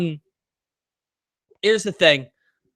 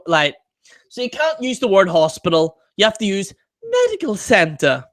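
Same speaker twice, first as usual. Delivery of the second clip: ordinary talk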